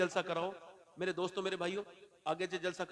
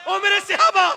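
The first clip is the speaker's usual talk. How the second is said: high, ringing summons; calling out; 15 dB louder than usual. Acted. Preacher voicing the Prophet calling companions, high raised call.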